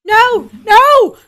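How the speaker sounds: loud, high cry of alarm; panicked shout. Acted. Player crying out as the parrot flies off, loud shout. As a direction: yell it in horror.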